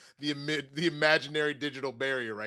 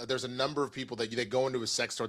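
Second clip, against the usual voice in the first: normal talking voice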